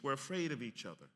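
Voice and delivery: normal talking voice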